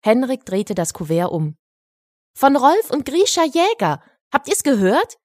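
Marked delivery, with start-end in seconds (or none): 2.3-5.2 put-on high-pitched, excited boy's announcement; excited calling out. A narrator's reading. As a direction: call the news out to everyone. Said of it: "rief er laut".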